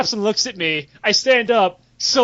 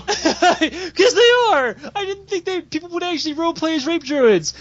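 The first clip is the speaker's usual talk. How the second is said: laughing; 9 semitones higher than usual. Real, not acted. Laughing through the explanation.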